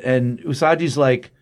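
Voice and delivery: usual speaking voice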